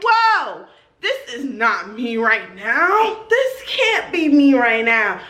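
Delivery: high "whoa", then slow and emphatic; reenacted amazed freak-out; mock disbelief. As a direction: stretch it out, stunned and amused. Acting out her own disbelief.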